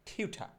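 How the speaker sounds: টিউঠা with an aspirated ঠ and open final আ, no r; corrected pronunciation. Shown as a demonstration. Teacher correcting learners' word for tutor, টিউঠা with aspirated "tha". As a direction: end টিউঠা on "tha", dropping the r. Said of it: "আমরা এখন থেকে বলবো".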